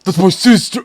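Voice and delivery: mock outraged shout; quoted catchphrase yell. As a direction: blurt it out like a meme clip. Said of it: "that's your sister".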